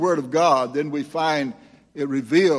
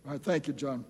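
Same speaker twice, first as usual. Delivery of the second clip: ordinary talk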